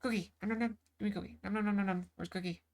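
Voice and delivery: soft, greedy monster munching; cookie monster voice. Pretend. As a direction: gobble it like a cookie-crazed puppet. Cookie-hungry creature voice.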